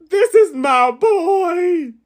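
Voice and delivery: high, slow, tearful wail; mock crying. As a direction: sob it like a grieving friend. Acted grief, crying.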